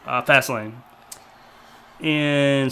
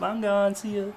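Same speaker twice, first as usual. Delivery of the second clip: higher-pitched imitation of someone leaving; mock hasty exit; 8 semitones higher, 5 dB quieter than usual. Host mimics the boss leaving right after the signing, high and offhand. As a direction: toss it off high, as if already leaving.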